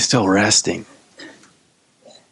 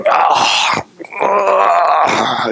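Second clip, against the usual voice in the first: long yawning groan; morning-stretch groan; 5 semitones higher than usual. Acted. Jesus getting up from his nap, groaning stretch. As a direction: stretch and groan as if just woken. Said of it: "he does his morning stretch".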